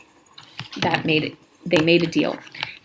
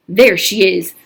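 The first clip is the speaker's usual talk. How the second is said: louder, a mob leader's shout; 9 dB louder than usual. Acted. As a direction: point her out to the mob, yelling. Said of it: "Trout shouted".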